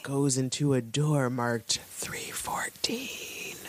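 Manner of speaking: slow ominous whisper; spooky whisper. Acted. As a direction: hush it like a suspense reveal.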